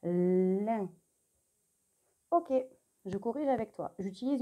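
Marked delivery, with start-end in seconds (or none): 0.0-0.9 "Lin" sounded out on a long l; drawn-out dictation. Shown as a demonstration. Teacher repeats after thinking time, "Lin" with a long l. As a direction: hold the l of "Lin", then open into the vowel. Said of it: "On va écrire la syllabe".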